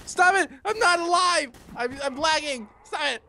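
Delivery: high-pitched flustered yelling; panicked protest. Real, not acted. Lagging player under attack, panicked protest. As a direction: protest frantically, pitched right up.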